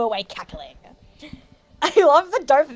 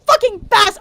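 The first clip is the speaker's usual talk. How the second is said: mock yell; 9 semitones higher, 7 dB louder than usual. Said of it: "deliver very straight".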